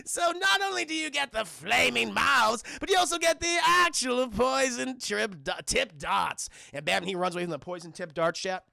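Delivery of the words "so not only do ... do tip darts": loud, high showman villain; hammed-up gleeful shouting; Joker. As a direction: boast like a mad game-show host. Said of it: "The Joker's saying".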